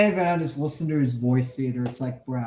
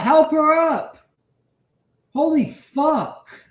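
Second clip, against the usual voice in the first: real anger; 4 semitones higher, 4 dB louder than usual. Real, not acted. Commentator yelling at a man who won't help his fallen mother, angry. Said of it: "It makes me angry".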